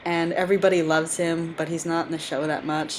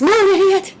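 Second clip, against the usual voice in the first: shouting; 10 dB louder than usual. Acted. Voicing along with the cartoon scene.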